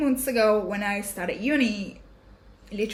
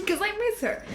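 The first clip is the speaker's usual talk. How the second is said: real tears breaking into a laugh, pitched higher; crying and laughing; 12 semitones higher, faster than usual. Real, not acted. To viewers, about missing her soul sister, tearful laugh. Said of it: "I'm crying".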